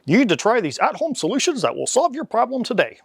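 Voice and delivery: put-on confident old-time radio announcer; 1940s broadcaster voice. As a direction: sell it like a vintage broadcaster. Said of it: "a nineteen forties broadcaster voice".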